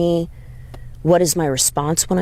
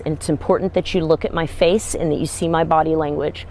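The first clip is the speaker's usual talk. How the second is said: normal talking voice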